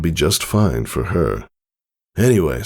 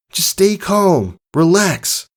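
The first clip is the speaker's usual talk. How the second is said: much higher, slow nervous self-talk; calming filly; slower than usual. Acted. Filly calming herself before the storm, high and slow. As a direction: soothe yourself, drawn out.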